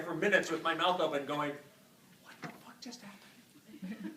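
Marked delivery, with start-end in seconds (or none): 2.2-4.1 slow breathy whisper with a laugh; stunned whisper. Retold or imitated. Stunned chiropractor after the patient's answer, whispering slowly. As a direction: whisper it in disbelief.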